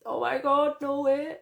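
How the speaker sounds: high, loud, astonished outburst; thrilled disbelief. Real, not acted. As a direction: burst out at seeing a friend appear. Spots friend Carrie joining.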